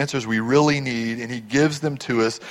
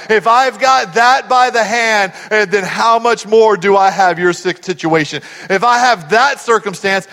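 loud, raised, driving preacher; impassioned preaching; 4 semitones higher, 6 dB louder than usual. Acted. Jesus reassuring believers through the preacher, impassioned and loud. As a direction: drive each comparison home forcefully.

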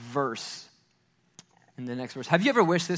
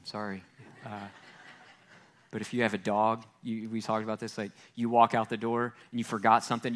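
usual speaking voice

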